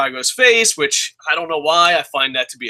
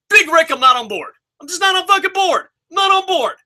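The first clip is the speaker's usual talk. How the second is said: shrill, high outraged shout; screaming at TV; 12 semitones higher, faster than usual. Retold or imitated. Fan screaming at his television, pitched very high. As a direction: scream it like the TV can hear you. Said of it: "I was screaming on my television".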